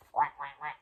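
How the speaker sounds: low-pitched duck quacks; imitated quacking. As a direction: quack three times, low and short. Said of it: "but this one says".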